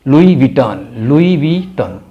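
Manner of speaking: लुई विटॉन said lwee vee-ton with silent s; लुई विटॉन, French style; brand name pronounced. Shown as a demonstration. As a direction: say Louis Vuitton as lui vi-ton.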